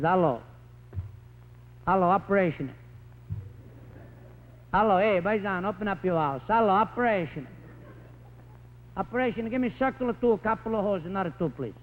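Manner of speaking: put-on a light Italian-American accent, shouting into the phone; an Italian father calling the county office with a light Italian accent; Italian immigrant on phone. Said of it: "it sounds like this, this is a telephone".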